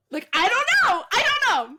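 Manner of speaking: high, loud flustered cry; excited outburst. Real, not acted. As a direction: blurt it out, flustered and giddy. Exasperated outburst over a crush.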